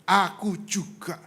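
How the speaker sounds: slow, weighty repeat; emphatic divine reply. Retold or imitated. God repeats the answer to the speaker, slow and deliberate. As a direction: spell it out slowly as God's answer.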